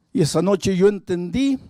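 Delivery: high, fervent preaching voice; impassioned testimony. Retold or imitated. Pastor retelling a drunk man's confession, impassioned preaching. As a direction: preach the retold confession with rising intensity.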